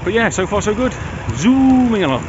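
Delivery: cheerfully pleased with speed; upbeat. Real, not acted. Cheerful ride progress.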